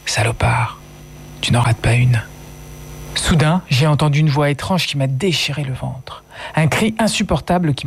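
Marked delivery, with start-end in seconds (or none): 0.1-2.2 hushed whisper into a dead man's ear; a low, bitter whisper; whispered insult. A narrator's reading. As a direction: breathe it bitterly, barely voiced. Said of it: "Je murmure à l'oreille de mon père".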